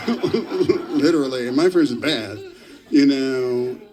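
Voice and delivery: slow, laughing through the word. Real, not acted. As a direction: laugh while admitting it. Laughing at own bad French.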